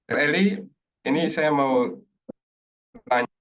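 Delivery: glitchy connection, words dropping out; choppy breaking audio. Real, not acted. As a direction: voice cutting in and out on a bad call line. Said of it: "[name], suaranya masih putus-putus".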